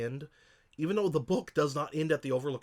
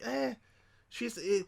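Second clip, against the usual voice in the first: high, scoffing "eh" of disdain; dismissive; 10 semitones higher, slower than usual. Retold or imitated. Telling co-host how Rose the Hat left them cold, scoffing high. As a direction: brush her off with a sneer. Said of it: "I was like".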